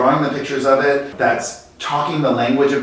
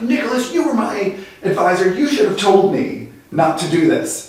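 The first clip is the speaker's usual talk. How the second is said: furious dictator; 7 semitones higher than usual. Retold or imitated. Dictator scolds his assistant Nicholas, furious. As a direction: blow up at him like a betrayed tyrant. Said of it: "and he's furious".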